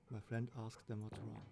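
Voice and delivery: usual speaking voice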